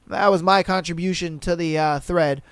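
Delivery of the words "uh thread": ordinary talk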